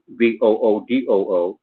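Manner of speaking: ordinary delivery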